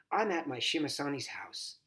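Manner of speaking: plain reading voice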